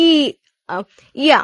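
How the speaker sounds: "ই", pause, "আ", then joined as "ইয়া"; sounds then blend. Shown as a demonstration. Teacher building a Korean vowel, "ই" and "আ" blended into "ইয়া". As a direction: say "ই" and "আ" apart, then glide them into "ইয়া". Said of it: "ই যুক্ত হয়ে এটা কি হয়েছে".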